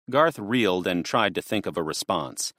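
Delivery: plain reading voice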